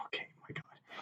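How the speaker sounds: breathy disbelieving whisper; whispered okay my God in disbelief; exasperated whisper. Retold or imitated. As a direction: drop to a whisper, done with him. Exasperated reaction retold.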